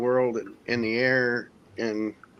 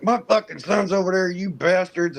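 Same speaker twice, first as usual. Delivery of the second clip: imitating the dad's angry outburst, higher and louder; pissed off old man; 8 semitones higher, 4 dB louder than usual. Father shouting at the news about his son, mock angry outburst. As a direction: play a cursing old man, fuming. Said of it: "this pissed off old man".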